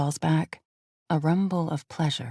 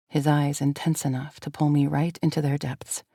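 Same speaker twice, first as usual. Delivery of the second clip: plain reading voice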